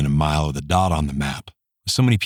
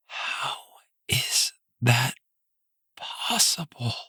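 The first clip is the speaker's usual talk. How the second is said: breathless shocked whisper; 7 semitones higher, 6 dB quieter, much slower than usual. A narrator's reading. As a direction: barely whisper it. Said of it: "Her voice was barely a whisper".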